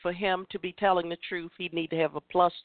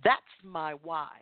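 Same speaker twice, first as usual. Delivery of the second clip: normal talking voice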